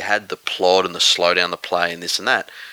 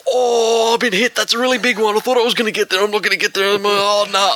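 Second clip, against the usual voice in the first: much higher, theatrical running commentary; high dramatic narration; 13 semitones higher, 4 dB louder, faster than usual. Retold or imitated. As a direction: narrate your own tackle in a high squeak. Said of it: "and he's like".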